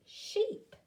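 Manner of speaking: "sheep" sung out high and falling; delighted teacher reveal; high excited reveal. Shown as a demonstration. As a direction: squeal the word in triumph. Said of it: "Blend them together".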